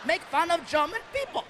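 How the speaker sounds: put-on German accent. Comic retells a German heckler, German accent. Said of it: "with the accent".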